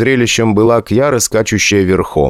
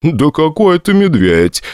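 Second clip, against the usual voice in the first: put-on slightly drunk, raised voice; tipsy voice; 8 semitones higher than usual. A narrator's reading. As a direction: talk like a tipsy reveller. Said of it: "пьяненьким голосом заявил Оралиус".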